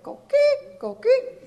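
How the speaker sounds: high, loud frog chirp coqui; imitating the tiny Puerto Rican frog's call. Shown as a demonstration. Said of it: "only an actress would even attempt to do that".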